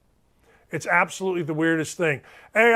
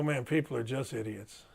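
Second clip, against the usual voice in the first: low, hushed grumble; retold confiding aside; 7 semitones lower, 12 dB quieter than usual. Host retelling his remark to the young cashier, quietly confiding. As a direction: drop it to a soft aside.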